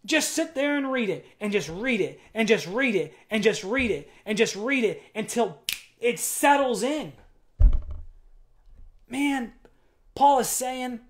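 high, loud urging exhortation; fervent preaching. Acted. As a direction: press the church to read it again and again. Preaching at full force.

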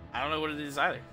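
ordinary talk, nothing marked

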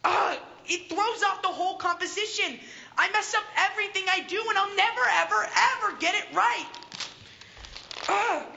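loud, fast, exasperated self-blame; frustrated outburst. Acted. Artist despairs over his mural.

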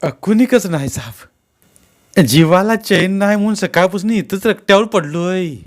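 high, loud protest of innocence; pleading suspect. A narrator's reading. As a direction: plead your excuse to the officer.

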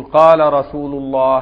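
loud, slow pulpit proclamation; preacher on the pulpit. Retold or imitated. Imitating the Friday preacher addressing the mosque, loud and slow. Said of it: "الخطيب بيتكلم يقول".

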